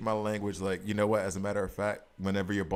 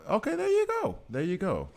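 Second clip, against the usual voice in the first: high excited praise; there you go, sung out high; excited cheer; 13 semitones higher, 4 dB louder than usual. Real, not acted. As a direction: cheer the win, pitch jumping up. Cheering game success.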